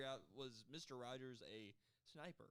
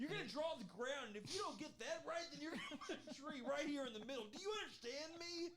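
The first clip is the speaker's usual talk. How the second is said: high, loud yelled painting lesson; drill sergeant; 11 semitones higher, 4 dB louder than usual. Pretend. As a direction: bark instructions at a scared painter. Bob Ross as drill sergeant.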